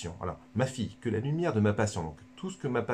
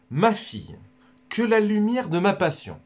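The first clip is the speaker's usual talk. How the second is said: hammed-up Jesus, much higher and louder; Jesus speaking; 11 semitones higher, 7 dB louder than usual. Jesus speaks to Luisa, proclaimed much higher and louder. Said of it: "je vais vous le redire".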